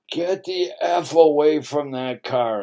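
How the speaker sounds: slow, loud mock yell of a cranky old man; retold yell. Cranky old man yelling at a kid on the cars, mock yell. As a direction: bark it out like the grumpy old guy at the car show. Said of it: "this old cranky guy ... yells at this".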